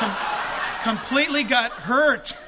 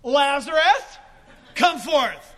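loud call, name first, then order; commanding shout; 3 semitones higher, 6 dB louder, much slower than usual. Acted. Preacher shows Jesus calling only Lazarus, commanding shout. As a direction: summon one man loudly. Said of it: "He had to say".

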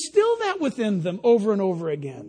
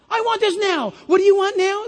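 put-on demanding kid voice, higher and louder; a spoiled child barking orders at a parent; bossy child; 7 semitones higher, 5 dB louder than usual.

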